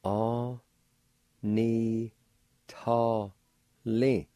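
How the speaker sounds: O, ni, to, li spoken as separate syllables with a pause after each. Shown as a demonstration. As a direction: sound out O ni to li one syllable at a time. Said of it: "First part is pronounced like this".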